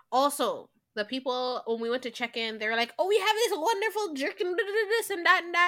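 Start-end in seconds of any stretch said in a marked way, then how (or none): 3.0-5.7 high-pitched resort staff patter; chirpy staff pitch. Acted. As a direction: imitate a gushing host, singsong. Acting out resort staff boasting.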